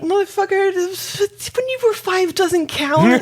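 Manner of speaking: high, drawn-out exasperated rant; mock outrage. Fan scoffing at an interviewer's claim, high mock outrage. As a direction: protest slowly in a high voice, half laughing.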